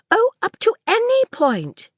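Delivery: up high, pressing the point; insist, lifting the words up; with emphasis. A narrator's reading. Girl contradicting Sir Claude, emphatic and high. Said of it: "Maisie returned with emphasis".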